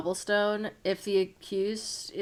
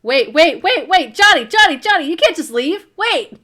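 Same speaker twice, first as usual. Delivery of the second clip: shouting; 8 semitones higher, 11 dB louder than usual. Real, not acted. Calling after a player leaving.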